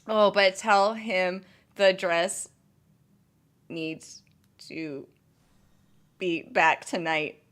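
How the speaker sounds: fading to near silence; quiet aside. Real, not acted. Vlogger murmuring a plan to someone off camera, fading soft. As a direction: drop the voice away to nothing. Whispered aside while laughing.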